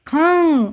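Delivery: "खांग" with a long nasal आ; "खांग" drawn out and ringing nasally; nasalised vowel demo. Shown as a demonstration. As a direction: hold "खांग" through mouth and nose. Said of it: "इसका उच्चारण हम करेंगे".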